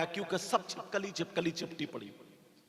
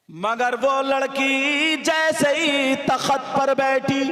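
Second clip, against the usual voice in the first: high, loud preaching at full force; fiery sermon; 10 semitones higher, 13 dB louder than usual. Acted. Preacher warning the girls in full voice. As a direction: drive the tale home like a preacher.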